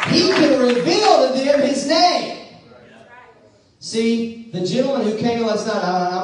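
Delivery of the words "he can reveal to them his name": forceful, driving preacher delivery; preaching. Real, not acted. Preacher telling congregation God will answer them, preaching. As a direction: preach it out to the whole room.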